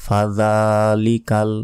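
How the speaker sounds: ফাযালিকাল said slowly, the ল stretched for a second; drawn-out demo. Shown as a demonstration. Teacher drills students on one-second elongation. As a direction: hold the zal sound of ফাযালিকাল. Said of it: "জাল এক second টেনে পড়তে হবে".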